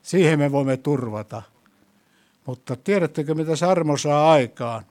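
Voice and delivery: ordinary delivery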